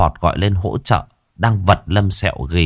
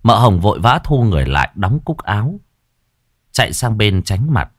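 plain reading voice

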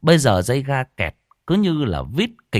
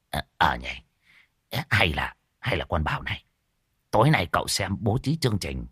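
softer, low-voiced rueful old man; wry, sheepish; 4 semitones lower, 5 dB quieter than usual. A narrator's reading. Old man sheepishly agrees and plans the evening, wry and subdued. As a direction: concede it quietly and ruefully. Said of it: "Lão cười khổ".

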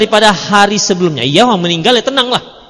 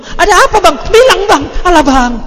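shrill, pushy, much higher questions; nagging wife; 10 semitones higher, 4 dB louder than usual. Retold or imitated. Unwise wife pestering her frightened husband, high pushy nagging. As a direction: badger him for answers. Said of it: "Coba seorang istri yang nggak cerdas".

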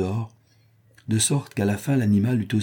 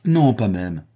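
firm, louder clipped refusal; stern; 5 dB louder, slower than usual. A narrator's reading. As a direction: shut the idea down sternly. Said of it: "d'une voix sévère".

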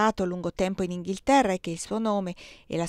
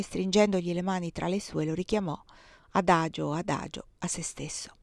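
ordinary delivery, nothing marked

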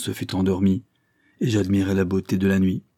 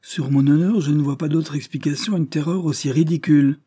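higher, louder exasperated uncle; irritated lord losing patience, higher and louder; growing irritation; 7 semitones higher, 3 dB louder than usual. A narrator's reading. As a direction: snap out the scorn for her fear. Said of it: "de plus en plus irrité".

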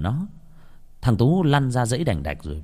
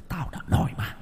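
eerie possessed laugh; 5 dB quieter than usual. Acted. Possessed boy laughing, taunting.

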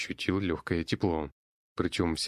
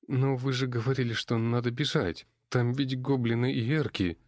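plaintive; 6 semitones higher than usual. Acted. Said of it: "жалостливо пробормотала девушка".

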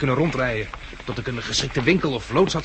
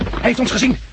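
high, loud warning of danger; panicked warning, much higher and louder; alarmed cry; 10 semitones higher, 6 dB louder than usual. Acted. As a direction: shout the alarm in fear. Gunman spotted them.

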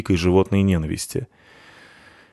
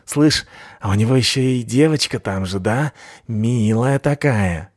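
creepy leering voice; 2 semitones higher than usual. A narrator's reading. Second thug, creepy drawl.

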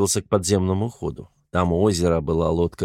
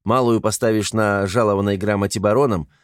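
ordinary delivery, nothing marked

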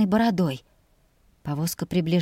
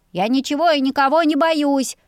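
put-on boyish boast; 7 semitones higher, 4 dB louder than usual. A narrator's reading. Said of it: "заявил мальчишка".

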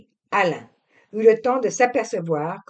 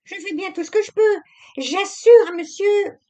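high, fast, flustered protest; the maid defending herself before her master; hasty; 14 semitones higher, 8 dB louder than usual. A narrator's reading. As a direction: tumble the words out near tears. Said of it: "avec précipitation".

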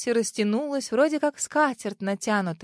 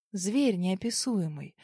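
ordinary delivery, nothing marked

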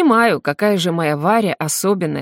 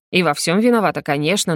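ordinary delivery, nothing marked

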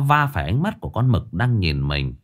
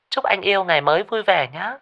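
much higher sugary female voice; sweet girlfriend voice; 9 semitones higher than usual. Acted. Girlfriend texting her man, sugary coo.

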